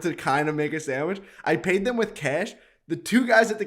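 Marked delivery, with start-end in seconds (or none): none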